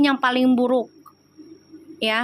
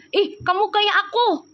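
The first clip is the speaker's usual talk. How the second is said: much higher, slow put-on voice; retold own exclaim; 9 semitones higher than usual. Recalling meeting her spirit twin, high amazed exclaim. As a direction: squeal it like a startled girl. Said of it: "aku bilang gitu".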